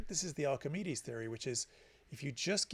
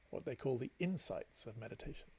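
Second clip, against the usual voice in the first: usual speaking voice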